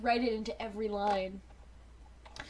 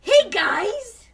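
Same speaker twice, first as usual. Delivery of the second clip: Cheetor voice; 10 semitones higher, 12 dB louder than usual. Acted. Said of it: "I was Cheetor originally".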